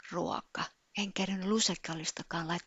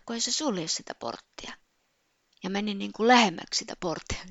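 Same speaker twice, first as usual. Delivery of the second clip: ordinary talk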